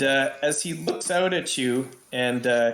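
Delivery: normal talking voice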